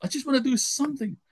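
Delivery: high-pitched, exasperated plea. Retold or imitated. Blistered worker complains to God, frustrated. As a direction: plead it high, retelling the exasperation. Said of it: "And I was more frustrated".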